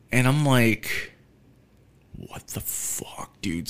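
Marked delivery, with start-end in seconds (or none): 2.2-3.7 breathy whispered disbelief; stunned whisper. Retold or imitated. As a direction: whisper it in shock. Whispered shock at found cards.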